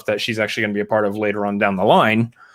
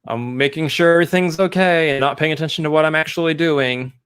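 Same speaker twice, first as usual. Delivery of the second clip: higher, imitated preoccupied voice; distracted; 5 semitones higher than usual. Imitating Herb watching others while trimming, lightly distracted. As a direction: voice someone preoccupied, pitched up. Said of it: "very distracted, very distraught".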